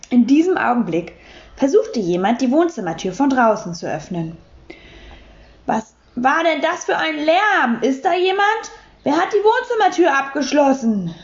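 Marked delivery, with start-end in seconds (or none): 5.6-11.1 a man calling through the door, raised and higher. A narrator's reading. Man behind a locked door calling out in alarm. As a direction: call out from outside the door. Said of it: "rief eine Männerstimme".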